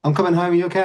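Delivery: high, cheerful wife voice; bright, carefree phone greeting; happy wife. Retold or imitated. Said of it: "She was all happy".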